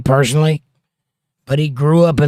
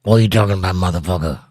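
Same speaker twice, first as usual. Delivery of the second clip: deep, low impression of a comedian; very deep wise-guy timbre; Joey Diaz; 6 semitones lower, faster than usual. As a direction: drop the voice very deep, accent only half there. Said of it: "you could do the Joey Diaz accent. Very deep".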